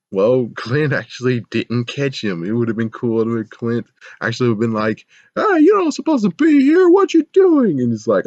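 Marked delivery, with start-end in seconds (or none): 5.4-7.8 squeaky guard voice. Pretend. Imagined guard confronting monster.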